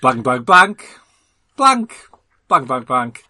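punchier bleeped cursing. Acted. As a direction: angrier fake swear words. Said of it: "Funny swear words they use on his planet".

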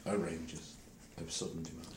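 trailing off quietly. Shown as a demonstration. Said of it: "don't use ranger".

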